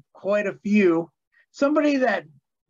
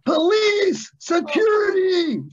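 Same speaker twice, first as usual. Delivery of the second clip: high, drawn-out mock scream; 13 semitones higher, 5 dB louder, slower than usual. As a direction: holler for help, stretching the words out high and loud. Retold yell of [name] calling security.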